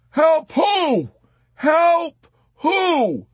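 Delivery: high, loud, desperate question; shouting back. A narrator's reading. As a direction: yell it back, demanding an answer. Frantic shouting back over intercom.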